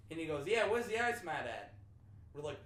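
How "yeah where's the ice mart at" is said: louder, higher Boston voice; imitated Boston accent. Imitating a Boston guy at a party asking for ice, Boston accent. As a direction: ask it with dropped Boston R's. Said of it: "There was a Boston guy".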